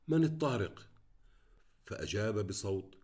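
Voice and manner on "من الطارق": usual speaking voice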